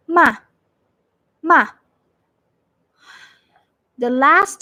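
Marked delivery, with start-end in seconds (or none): 0.1-1.7 one short downward stroke on "ma"; fourth tone, falling. Shown as a demonstration. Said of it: "going down".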